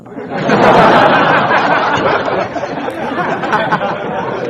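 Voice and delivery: loud canned giggle; giggle effect. Shown as a demonstration. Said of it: "meron din siyang giggle".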